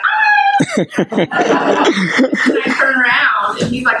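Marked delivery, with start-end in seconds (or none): 0.1-1.1 sudden high squeal of fright, half laughing; squealing scared yelp that dissolves into laughter; retold scream. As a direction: yelp like you just saw a snake. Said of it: "And I'm like".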